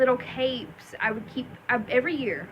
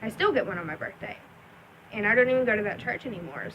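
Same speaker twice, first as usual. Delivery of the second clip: normal talking voice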